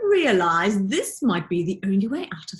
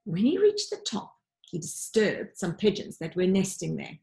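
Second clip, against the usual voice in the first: plain reading voice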